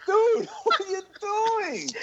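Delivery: high, slow, cracking with laughter; laughing mock yell. To the friend shaking the car, laughing shout. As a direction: yell at him through laughter. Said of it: "We're yelling at him".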